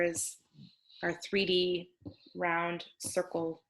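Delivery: usual speaking voice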